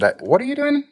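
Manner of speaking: Lindsay lifted into a high, far-off voice; high curious friend voice. Retold or imitated. Friend Lindsay asking what they're doing, high and curious. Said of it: "she said".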